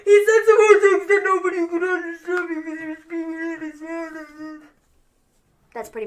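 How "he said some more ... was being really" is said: blubbering fake sobs, high and garbled; mock crying. Host imitating Jay's promo nobody could understand, hammed-up blubbering. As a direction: bawl through the words until they blur. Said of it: "crying some more, and he's all like".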